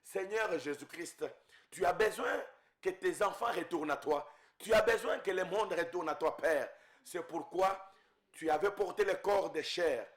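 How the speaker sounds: ordinary talk, nothing marked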